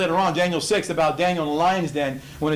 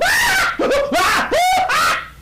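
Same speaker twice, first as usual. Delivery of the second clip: a loud whooping burst of laughter, much higher; big shouting laugh; 6 dB louder than usual. Real, not acted. Preacher cracking himself up, loud shouting laugh. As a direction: let out a huge, high laughing shout. Laughing at his own retelling.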